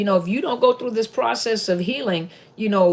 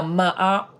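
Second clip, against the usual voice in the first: ordinary talk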